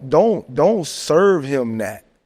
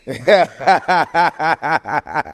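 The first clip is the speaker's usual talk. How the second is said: long, rolling belly laugh; drawn-out cackling laugh; big laugh. Real, not acted. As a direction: burst out laughing at your own joke. Laughing at his own punchline.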